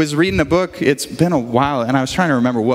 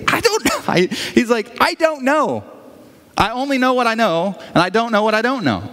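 happy blind man; 7 semitones higher than usual. Retold or imitated. Blind man answers cheerfully.

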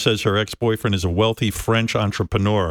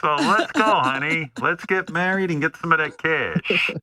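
much higher, hammed-up French accent; mock French lover; 9 semitones higher than usual. Host mocks the French suitor wooing Tan Mom, high hammed-up French accent. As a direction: play a cheesy French playboy in a silly high voice. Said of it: "Does he speak with a French accent?".

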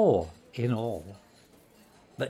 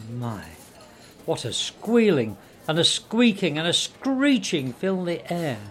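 plain reading voice

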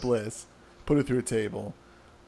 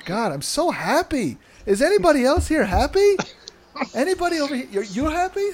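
giddy Finn; 5 semitones higher than usual. Retold or imitated. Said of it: "He's, he's so happy to be here".

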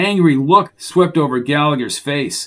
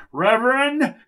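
raised, projected preacher's address; high, carrying pulpit oratory without real volume; 5 semitones higher, 4 dB quieter than usual. A narrator's reading. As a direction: proclaim it as if from a pulpit. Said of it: "Gallagher's booming pulpit voice resounded".

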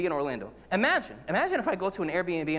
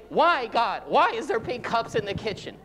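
louder, higher exasperated plea to God; mock frustrated prayer; 5 semitones higher, 4 dB louder than usual. Petty prayer over pink cups, mock exasperated. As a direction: ham up a petty complaint to heaven. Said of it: "I was saying".

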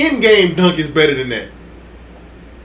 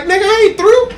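defiant quote; 12 semitones higher, 4 dB louder than usual. Retold or imitated. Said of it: "Vince was like".

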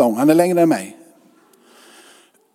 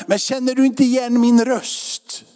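slow, higher mock-offended ask; a hint of a wounded friend, pitched up; hurt question; 8 semitones higher than usual. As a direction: lightly act out the slighted friend, slowed down. Visitor asks, retold quote.

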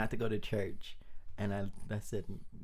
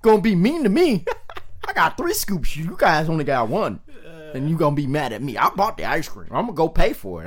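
mock-indignant grandpa, raised voice; loud protest; 5 semitones higher, 9 dB louder, faster than usual. Grandpa defending himself to the grandkids, loud mock protest. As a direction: argue back loudly, playing it for laughs.

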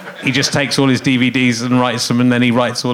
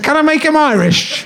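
put-on Irish accent; 12 semitones higher than usual. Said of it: "I said".

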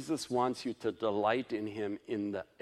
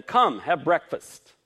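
raised preaching voice, drawn out; 5 semitones higher, 11 dB louder, slower than usual. Acted. Pastor preaching the breakfast story to the congregation, raised and drawn out. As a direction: lean into the invitation like a preacher.

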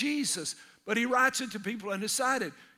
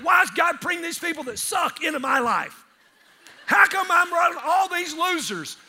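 mock whine; 6 semitones higher, 6 dB louder than usual. Mock complaint about people.

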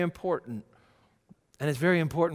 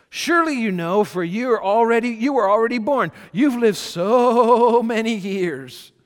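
sarcastic God; 8 semitones higher, 10 dB louder than usual. Acted. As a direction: tease Job grandly from above. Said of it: "God right here is getting a little sarcastic".

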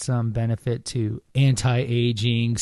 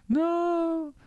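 high, drawn-out fake cry of dread; mock horror. Host pretends a demon is coming, mock horrified wail.

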